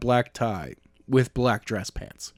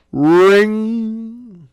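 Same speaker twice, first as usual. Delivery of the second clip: phone ring sound; 10 semitones higher, 8 dB louder than usual. Acted. Phone ringing in the story.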